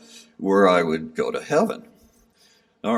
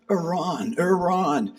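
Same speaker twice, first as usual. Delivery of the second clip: higher, drawn-out call of Iran; mild imitation of a man calling Iran; 10 semitones higher, slower than usual. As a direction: mimic a man calling Iran across a camp. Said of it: "he goes".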